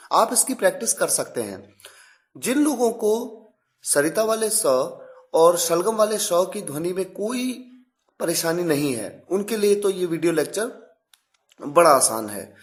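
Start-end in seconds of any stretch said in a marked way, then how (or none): none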